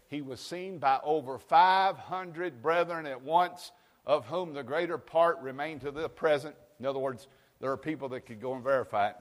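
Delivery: fiery pulpit reading; preaching at full force. Acted. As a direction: proclaim each word to the congregation.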